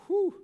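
high loud whistle of shock; startled whew at the rebuke. Real, not acted. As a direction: blow it out like you've been knocked back. Reacting to imagined rebuke.

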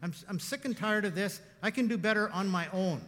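preacher replaying his rant at God, heated; little rant. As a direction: vent it like a hurt man fed up. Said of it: "let me have my little rant".

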